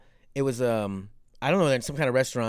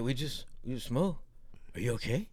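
blitzed stoner; 3 semitones lower, 11 dB quieter than usual. Retold or imitated. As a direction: retell it as a dazed, hushed smoker. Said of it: "he just looked blitzed".

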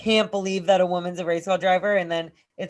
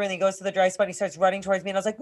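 normal talking voice

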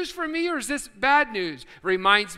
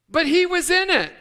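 laughing punchline; 8 semitones higher, 6 dB louder than usual. Acted.

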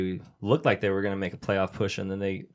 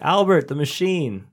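higher, louder, slow hammed-up hailing of a nickname; mock coworker announcing; 6 semitones higher, 5 dB louder, slower than usual. Retelling a sketch to podcast friends, hammed-up announcing. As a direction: drag it out like a proud nickname.

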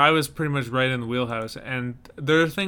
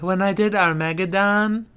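high, breathy-free starlet impression; imitating an actress; 7 semitones higher, 4 dB louder than usual. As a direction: say it like a proud movie star. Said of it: "She's like".

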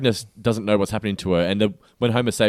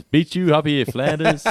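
Homer taunt; 6 semitones higher, 4 dB louder, slower than usual. Retold or imitated. Quoting Homer taunting Flanders.

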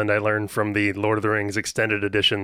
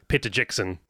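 lightly attempted New Zealand accent; the name in a rough New Zealand accent; 3 semitones lower, 4 dB quieter than usual. Shown as a demonstration. Host shows his one New Zealand phrase, mild New Zealand accent. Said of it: "say in a New Zealand accent".

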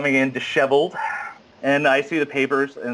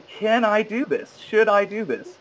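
self-doubting; 8 semitones higher than usual. Retold or imitated. Sobered up, doubting himself next morning.

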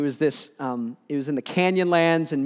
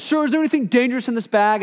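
higher, fast stern guard question; officer questioning; 7 semitones higher, 3 dB louder, faster than usual. Retold or imitated. Guard repeats his question to the speaker, quick and stern. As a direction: push the question again like a guard.

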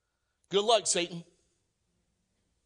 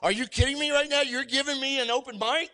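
eager disbelief; 6 semitones higher than usual. Retold or imitated. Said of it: "Stephen's like".

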